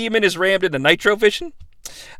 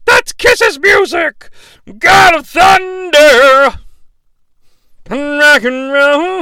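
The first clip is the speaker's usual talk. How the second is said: high, slow announcer shout; excited yell; 6 semitones higher, 4 dB louder, much slower than usual. Retold or imitated. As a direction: belt it out like a hyped commentator. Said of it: "Tony yells".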